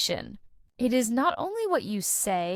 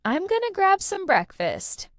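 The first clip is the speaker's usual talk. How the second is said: higher-pitched, casual everyday phrasing; natural and casual; 9 semitones higher than usual. Shown as a demonstration. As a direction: say it like a relaxed native speaker, pitched up. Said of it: "a more natural version is".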